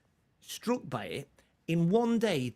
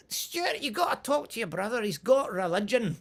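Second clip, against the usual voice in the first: Mum; 7 semitones higher than usual. Retold or imitated. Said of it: "so Mum was like".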